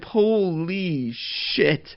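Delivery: very drawn-out, high exclamation; awestruck excitement. Real, not acted. Podcaster imagining an upset title win, awestruck and excited. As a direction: stretch it out slowly in high-pitched amazement.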